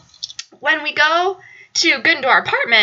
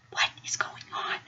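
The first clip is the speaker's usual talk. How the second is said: whisper; 16 dB quieter than usual. Retold or imitated. Roommates confused, whispering.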